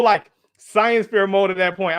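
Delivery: usual speaking voice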